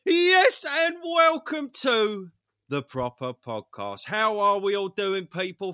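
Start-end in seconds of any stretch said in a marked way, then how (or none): 0.1-4.1 loud, slow show opener; second go at the welcome, loud and steady; big intro shout. Real, not acted. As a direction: announce the show with full force. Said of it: "My voice broke".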